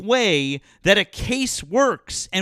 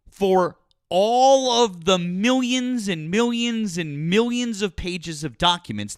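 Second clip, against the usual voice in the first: ordinary delivery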